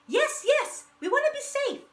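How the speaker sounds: high, loud, breathless eagerness; eager rush. A narrator's reading. Frightened barnyard animals eagerly agreeing to follow, high and excited. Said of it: "they all rushed".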